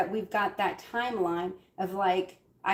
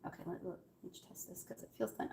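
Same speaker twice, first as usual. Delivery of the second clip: half-whispered, testing it out; whisper to self; 17 dB quieter, faster than usual. Real, not acted. Vlogger whispering to herself while testing if it's done. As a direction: murmur to yourself while checking.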